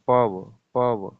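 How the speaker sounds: पव, पव drawn out slowly and loudly to show the अव sound; sounding out the syllable. Shown as a demonstration. Teacher isolating the अव sound in पवन, drawn out. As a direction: stretch पव, पव slowly so the अव stands out. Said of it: "अव आ रहा है".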